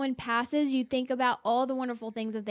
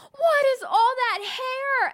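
much higher, loud horrified imitation; dramatic boyfriend; 11 semitones higher, 5 dB louder than usual. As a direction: ham up the boyfriend's shock at the video. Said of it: "And then he was like".

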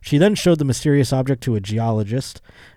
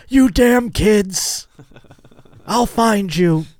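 put-on much higher, louder, slow cranky old man; grumpy old man yell; 9 semitones higher, 4 dB louder, much slower than usual. Yelling at imaginary kids who threw the rock, cranky old man. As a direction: shake your fist at the kids in the sky.

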